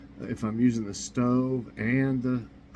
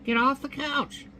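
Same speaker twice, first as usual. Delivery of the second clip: squawky parrot bark, pitched very high; 13 semitones higher than usual. Acted. Parrot scolding the dog, high squawky order. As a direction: screech it like a bossy parrot. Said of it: "The parrot says".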